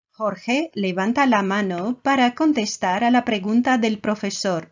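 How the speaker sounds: ordinary talk, nothing marked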